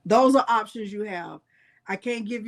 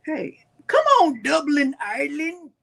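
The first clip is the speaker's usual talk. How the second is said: excited greeting; 4 semitones higher, 4 dB louder than usual. Real, not acted. Greeting Irish viewer, excited.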